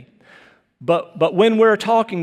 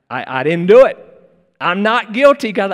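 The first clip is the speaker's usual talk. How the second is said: mock denial; 2 semitones higher, 6 dB louder than usual. Acted sinner denying to God.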